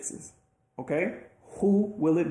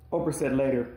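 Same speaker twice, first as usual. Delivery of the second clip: ordinary delivery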